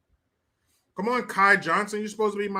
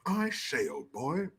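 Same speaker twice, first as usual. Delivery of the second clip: mock posh English; 2 semitones lower, 8 dB quieter, slower than usual. Mimics posh English, restrained first take.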